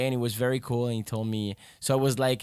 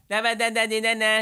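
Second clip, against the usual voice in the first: high-pitched French-speaker demo on "na na"; French way of talking; 8 semitones higher than usual. Shown as a demonstration. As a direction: show how the French place the voice, high. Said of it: "French people, we talk with here".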